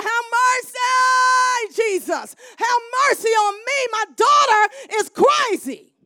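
loud, high and slow pleading cry; desperate crying out. Acted. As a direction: beg Jesus at the top of your voice. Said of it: "She kept going".

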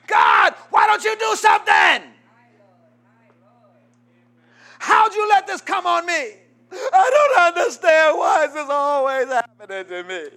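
high, loud, mock-tearful wail at God; mock screaming complaint. Preacher mocking people who only pray in a jam, wailing high and loud. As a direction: ham up a whiny cry to heaven. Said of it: "start screaming and hollering".

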